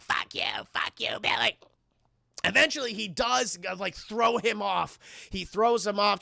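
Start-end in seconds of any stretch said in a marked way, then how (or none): 0.0-1.6 gremlin villain voice. Retold or imitated. Streamer as Stripe attacking Billy, rasping creature voice. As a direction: rasp curses like a little monster.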